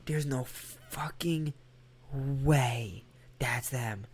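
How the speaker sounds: slow, awestruck whisper; hushed disbelief. Retold or imitated. Reacting to seeing the band, slow hushed disbelief. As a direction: drag it out in a stunned whisper.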